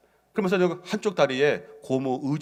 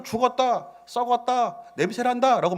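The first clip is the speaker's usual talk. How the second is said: mock proclamation; 10 semitones higher than usual. Quoting people declaring death.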